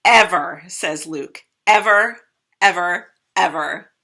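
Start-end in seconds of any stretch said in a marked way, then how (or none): 0.0-0.6 emphatic agreement. Acted. 1.7-3.9 loud repeated ever, fading; emphatic repetition. Acted. Brother insisting it's the worst idea, loud repeated emphasis.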